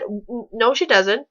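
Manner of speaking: ordinary talk, nothing marked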